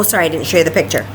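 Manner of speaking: ordinary delivery, nothing marked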